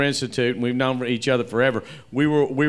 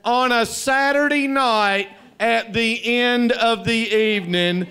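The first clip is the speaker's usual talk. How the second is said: high, slow, laughing boss brag; smug manager; 8 semitones higher, slower than usual. Retold or imitated. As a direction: gloat like a sly manager. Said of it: "He goes".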